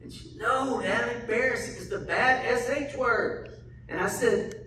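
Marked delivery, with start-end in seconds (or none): 0.3-3.5 put-on higher, childlike refusal; embarrassed little girl. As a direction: play the shy daughter who won't say it. Daughter embarrassed.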